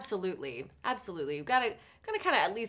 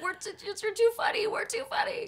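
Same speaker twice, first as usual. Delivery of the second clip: laughing protest; 13 semitones higher than usual. Retold or imitated. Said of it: "I was like".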